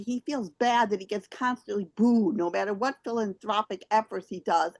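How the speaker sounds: normal talking voice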